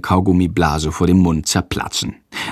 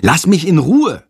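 higher, forceful rebuff; angry snarl; 8 semitones higher, 4 dB louder than usual. A narrator's reading. Jendrik telling his teasing classmate off, snarling. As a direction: snap back at the tease. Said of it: "faucht Jendrik".

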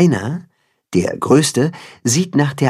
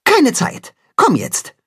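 short, high, hurried reply; no time to explain; brisk urgent answer; curt; 14 semitones higher, slower than usual. Acted. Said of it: "antwortet knapp".

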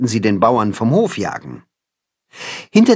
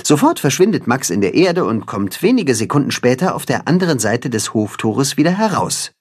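usual speaking voice

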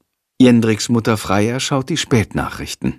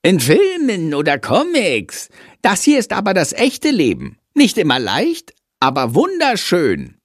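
higher, lightly voiced mimicry of a friend; a small bat mimicking his friend, lightly done; mocking imitation; 8 semitones higher than usual. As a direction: parrot his friend's words back at him. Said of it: "Shandor äfft Jendrik nach".

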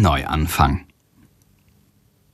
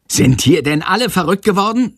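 contained angry shout; 10 semitones higher than usual. A narrator's reading. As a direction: scold the class with a raised pitch. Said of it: "schreit Herr Lersikow". (aufgebracht und hoch, verhalten geschrien)

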